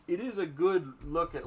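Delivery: usual speaking voice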